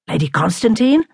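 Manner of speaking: plain reading voice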